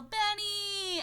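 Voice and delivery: high, drawn-out call; calling out the name. Retold or imitated. Calling the cat on coming home, high drawn-out yell. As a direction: sing out to a pet in another room. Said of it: "but I'll just yell".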